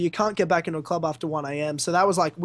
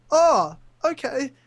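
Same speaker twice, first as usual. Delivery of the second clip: hammed-up teary gratitude; close to tears; 7 semitones higher than usual. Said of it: "close to tears".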